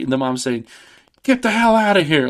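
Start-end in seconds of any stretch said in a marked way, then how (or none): 1.2-2.3 high-pitched mock scream; screaming mom. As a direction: shriek it at the kids at the door. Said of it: "Like, she screams".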